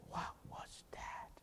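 slow whisper of wonder; boy whispering in awe at the miracle; awed whisper. Retold or imitated. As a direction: breathe it out in disbelief.

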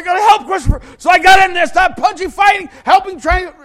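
shouted high and loud, breathless action; yelling out the blow-by-blow of a fight; frantic fight narration. Retold or imitated. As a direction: relive the brawl at full volume. Re-living the schoolyard fight.

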